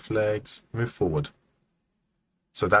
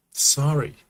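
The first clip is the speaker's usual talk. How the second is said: American-accented apology, higher-pitched; 6 semitones higher, 3 dB louder than usual. Shown as a demonstration. Animator demos the character's apology in an American accent. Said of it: "That's my American accent".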